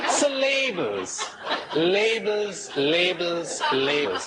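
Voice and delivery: starts high through laughter, then settles down; laughing. Real, not acted. Speaker still laughing as he repeats labels, calming down. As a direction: laugh out the word labels and let it fade.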